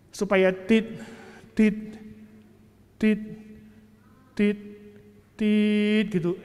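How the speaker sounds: spaced-out mock beeps, each one alone; drawn-out imitated beeps with long gaps; slow monitor beeps. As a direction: copy a heart monitor ticking slowly. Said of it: "Itu nada detak jantung".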